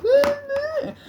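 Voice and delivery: high, loud mock cry; high falling cry. Tripped mate flying over, high mock squeal. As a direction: squeal like someone sent flying.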